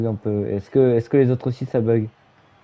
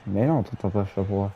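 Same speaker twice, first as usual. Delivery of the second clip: ordinary delivery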